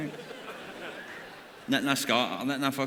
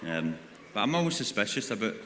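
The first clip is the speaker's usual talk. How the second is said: usual speaking voice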